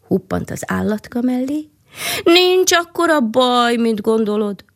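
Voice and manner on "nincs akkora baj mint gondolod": comforting creature. A narrator's reading. Forest creature comforts the hedgehog.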